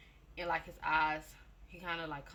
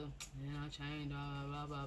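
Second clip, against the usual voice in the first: mocking his speech; 5 semitones lower, 9 dB quieter, much slower than usual. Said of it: "there he go with the".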